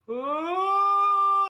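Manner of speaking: long, loud, high wail; trance wail. Acted. Creature identifying potions in a violent trance, loud high wail. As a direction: let out a sustained high-pitched cry.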